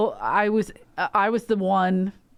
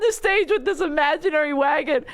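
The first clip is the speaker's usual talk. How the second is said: higher, louder, laughing through the words; laughing while telling; 8 semitones higher, 3 dB louder than usual. Real, not acted. Storyteller cracking up describing the wagon, laughing.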